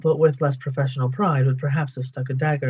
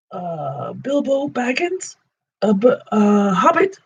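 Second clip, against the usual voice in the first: high, slow, stammering terror; frightened hobbit; 6 semitones higher, slower than usual. Acted. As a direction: stammer your name out, shaking all over. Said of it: "said poor Bilbo, shaking all over".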